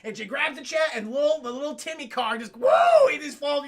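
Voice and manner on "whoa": very high, loud whoa; hammed-up cry of alarm; mock falling yell. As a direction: yelp as the car drops. Imitating car dropping.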